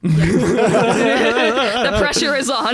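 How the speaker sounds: slow, loud laughing drawl. Real, not acted. Laughing mock dramatic warning.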